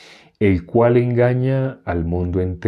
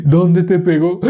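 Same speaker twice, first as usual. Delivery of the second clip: raised, loud mock-dad question; concerned father impression; 8 semitones higher, 5 dB louder, slower than usual. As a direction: cut in as the dad over the wailing. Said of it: "el, el papá". (papá preguntando fuerte, imitado)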